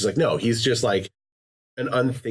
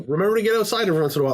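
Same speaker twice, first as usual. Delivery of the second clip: quick, high chirpy reminder; mock game reminder; 11 semitones higher, much faster than usual. Podcaster mimicking a game splash screen, fast and high. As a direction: rattle it off like a perky splash screen. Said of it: "where it's just like".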